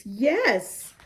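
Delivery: plain reading voice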